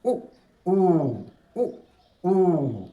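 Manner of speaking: brief उ, long held ऊ; short-long vowel drill. Shown as a demonstration. Teacher models correct pronunciation, short उ then long ऊ. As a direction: cut उ short and sustain ऊ. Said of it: "दो second".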